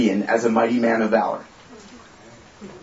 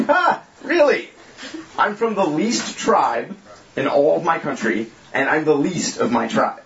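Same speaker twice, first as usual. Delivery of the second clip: mocking Gideon; 2 semitones higher than usual. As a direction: play Gideon scoffing at the angel. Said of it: "And he's like".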